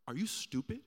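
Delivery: put-on loud, cutting demand at a child; harsh angry father. Father berating his seven-year-old in the barbershop, harsh anger. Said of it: "his tone is just crazy".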